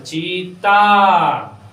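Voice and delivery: चीता with the final aa held very long and loud; long aa stressed. Shown as a demonstration. Teacher stresses the long aa for the class, चीता held long. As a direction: draw out the end of चीता. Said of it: "आ पर भी उच्चारण पर जोर देना है".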